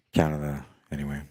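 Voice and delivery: ordinary delivery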